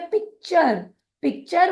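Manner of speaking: पिक्चर ending in "char", not "tur"; word demo. Shown as a demonstration. Teacher models the ture sound for trainees, clearly. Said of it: "उच्चारण करते हैं चर के रूप में".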